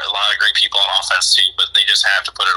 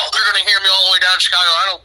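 high, quick imitation of a riled dad; loud, worked-up dad; 10 semitones higher, faster than usual. Son imitating dad yelling at the Bears game, worked up. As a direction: insist stubbornly in a raised-pitch dad voice. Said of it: "remember how loud he used to get".

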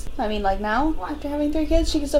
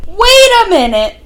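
loud, high exclamation; sudden surprise; 8 semitones higher, 11 dB louder than usual. Real, not acted. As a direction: blurt it out at a sudden discovery. Recognizing a character on screen.

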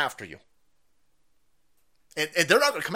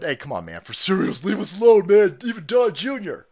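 louder mock plea on leave us alone; hammed-up whine to be left alone; mock pleading quote; 6 dB louder than usual. Mocking Bud Light asking boycotters to leave them alone. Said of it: "they said".

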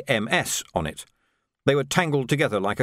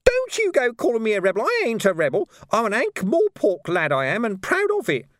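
indignant; 11 semitones higher, 3 dB louder than usual. A narrator's reading. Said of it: "Nobby shouted".

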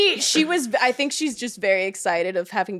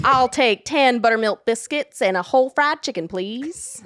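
put-on thick Southern accent; 3 semitones higher, 3 dB louder than usual. Guest repeats the host's line in a Southern grandma accent. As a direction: lean hard into Southern vowels like grandma. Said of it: "this is what my grandmother sounds like".

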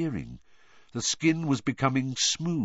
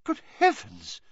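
shocked gasp. Acted. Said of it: "said Professor McGonagall".

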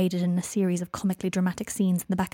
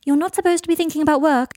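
high, loud chiding; scolding friend; 8 semitones higher, 8 dB louder than usual. A narrator's reading. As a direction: call out a telling-off, pitched up. Hermione scolds from behind Ron.

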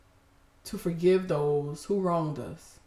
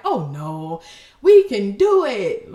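mock can-do cheer; 2 semitones higher, 11 dB louder, slower than usual. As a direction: imitate a relentlessly positive cheerleader. Imitating her old upbeat self.

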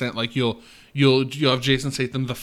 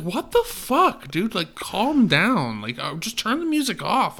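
exasperated viewer; 8 semitones higher than usual. Retold or imitated. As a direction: complain like a fed-up viewer. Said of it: "And it's like".

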